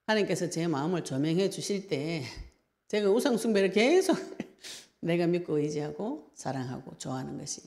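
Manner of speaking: usual speaking voice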